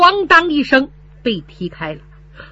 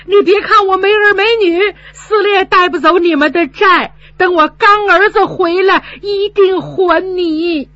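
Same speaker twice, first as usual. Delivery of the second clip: high, loud, defiant reply; defiant retort; 10 semitones higher, 5 dB louder than usual. Retold or imitated. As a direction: spit it back in a huff. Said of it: "我赌气说". (赌气顶撞，又高又响)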